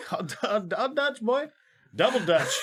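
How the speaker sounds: giggling pun. Real, not acted. Silly wordplay riffing.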